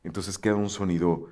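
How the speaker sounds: ordinary talk, nothing marked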